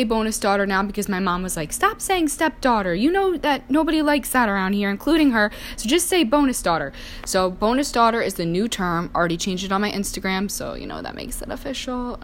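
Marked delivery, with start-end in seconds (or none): 1.7-6.9 higher-pitched imitation of a correcting mom; imitating mom. Mom tells daughter to drop the word stepdaughter, imitated higher-pitched. 10.5-12.2 soft, mock-official put-on voice; joking put-on voice. Speaker jokes that her new term is official, in a soft put-on voice. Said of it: "I don't really talk like that".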